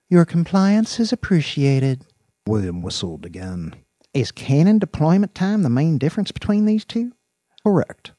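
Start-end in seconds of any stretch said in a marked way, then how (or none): 0.1-2.1 higher synthetic AI voice; cold polite machine announcement; dystopian announcer. A narrator's reading. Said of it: "The computerized voice spoke again". 4.1-8.1 higher synthetic female AI voice; computer voice. A narrator's reading. Computer answers William.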